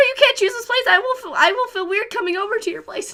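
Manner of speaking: imitating nervous Makoto begging Haru; whiny plea. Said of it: "he's like".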